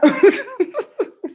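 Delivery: a high burst of laughter at his own joke; amused high laugh. Real, not acted.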